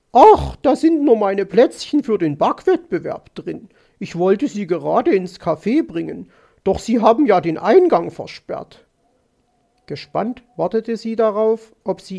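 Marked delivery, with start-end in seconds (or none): 0.1-8.8 lively, louder magpie voice; needling magpie lady. Acted. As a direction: explain pointedly as Mrs. Magpie. (aufgebracht plappernde Elster)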